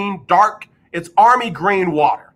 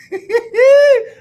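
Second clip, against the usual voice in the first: loud laugh; 6 dB louder than usual. Real, not acted. Reacting to a funny text.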